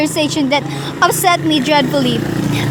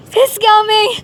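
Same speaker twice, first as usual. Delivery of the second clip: panicked cry, louder and higher; terrified cry; 3 semitones higher, 4 dB louder than usual. Acted. Invalid hearing footsteps on the stairs, crying out in terror. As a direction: she hears the killer coming and breaks. Intruder climbing stairs toward her.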